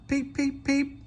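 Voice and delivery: high piping peep peep; engine whistle. Acted. Percy whistles to the brakes guard, high piping. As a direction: pipe it like a little whistle. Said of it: "whistled Percy".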